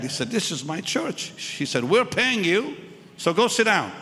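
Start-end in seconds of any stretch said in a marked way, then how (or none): 0.3-1.3 higher-pitched indignant protest; mad priest. Retold or imitated. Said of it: "The priest was so mad". 1.8-3.9 high, loud imitation of a commanding mom; tough mother. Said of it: "She was tough like nails".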